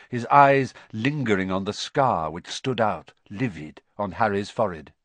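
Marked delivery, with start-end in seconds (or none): none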